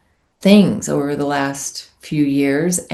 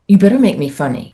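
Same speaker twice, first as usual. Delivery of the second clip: retold demand; 4 semitones higher, 7 dB louder, faster than usual. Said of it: "I remember saying to Michael Showalter".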